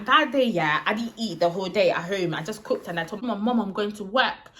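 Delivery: normal talking voice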